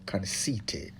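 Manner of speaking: breathy whisper of the missing word; feed the reader the word under your breath; whispered prompt. Real, not acted. Helper prompting the young reader, whispered.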